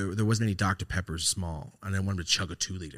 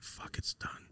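barely voiced, sinking realization; whispered resignation; 13 dB quieter, slower than usual. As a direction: let it drop to a hushed whisper. Retold realization that uncle died.

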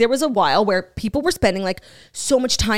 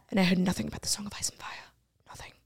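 fast whispered aside; hushed grievance; 11 dB quieter, much faster than usual. Acted. Spare brother never told of song, whispered grievance. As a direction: drop to a quick, resentful whisper.

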